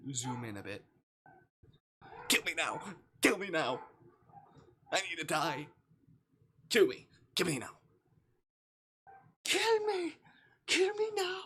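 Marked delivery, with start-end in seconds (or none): 2.3-7.7 tag. Pretend. Mock begging after embarrassing joke. 9.4-11.4 very high, loud, hammed-up plea; mock begging squeal.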